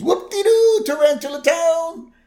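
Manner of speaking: high gleeful squeal; mock overjoyed old man; Burns quote. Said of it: "Burns goes".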